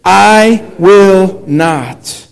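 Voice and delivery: very slow, word by word; leading in unison. Shown as a demonstration. Pastor has the congregation say Peter's vow along, very slow unison lead. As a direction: space out each word so others can join. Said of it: "say that with me here".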